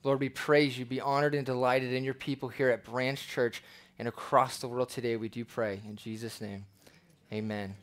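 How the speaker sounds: low, hushed public prayer. Real, not acted. Pastor closing a prayer for his church, prayerful.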